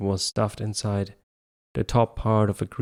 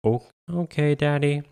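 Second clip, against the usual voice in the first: put-on higher, small tearful child voice; sniffling child; 6 semitones higher than usual. A narrator's reading. Son sniffling after being scolded by dad. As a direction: answer like a kid still crying. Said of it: "David sniffled".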